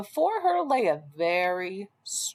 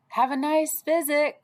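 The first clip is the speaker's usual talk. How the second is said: high, louder parting call from the wolf; cried out; 7 semitones higher, 3 dB louder than usual. Acted. Wolf calling goodbye to Red, a high cheery cry. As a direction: call it after her as you leave. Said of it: "cried Wolf".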